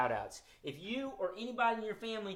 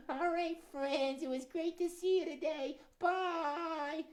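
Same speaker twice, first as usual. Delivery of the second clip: high, slow, timid critter voice; shy little muskrat voice, drawn out; high timid puppet muskrat; nervous puppet; 13 semitones higher, slower than usual. Acted. Puppet Gus says goodbye.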